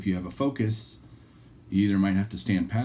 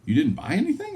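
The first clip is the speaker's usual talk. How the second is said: surprised wife; 13 semitones higher, 3 dB louder than usual. Retold or imitated. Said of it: "Julie's like".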